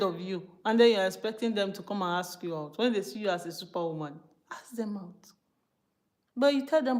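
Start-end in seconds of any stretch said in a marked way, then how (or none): 4.5-5.3 unvoiced whispered aside; whispered tip. Real, not acted. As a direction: drop to a secret whisper.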